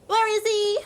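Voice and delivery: high, loud, desperate question; distraught demand. Acted. Player's character demands to know where dad is, distraught. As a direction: demand it in rising panic. Acted demand for missing father.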